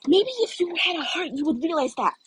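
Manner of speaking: put-on girl voice standing her ground; indignant girl. Uraraka snapping back at Bakugo.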